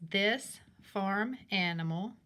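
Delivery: slow, deliberate read-aloud of the sentence; teacher slowly reading the sentence frame to young kids. Shown as a demonstration. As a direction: read it out slowly for the class, word by word. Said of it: "it says".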